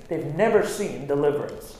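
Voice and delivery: usual speaking voice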